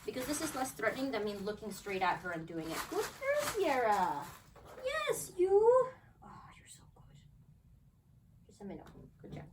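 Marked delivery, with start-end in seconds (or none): 2.9-5.5 much higher praise to Sierra; squeaky dog praise. Real, not acted. 6.2-9.4 slow whisper to the dog; whispering to a good dog; whispered praise. Real, not acted.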